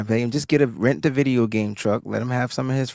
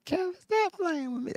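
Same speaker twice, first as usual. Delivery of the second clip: very high, softer mimicking voice; Jack impression; 8 dB quieter than usual. Co-host answering with own Jack mimic, high and small. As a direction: squeak it quietly, mimicking a woman. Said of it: "That was a good mimic".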